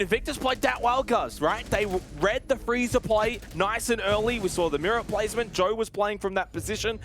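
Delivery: an animated caster praising the defence; lively analysis. Real, not acted. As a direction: talk it up with energy. Analyst praising the winning team.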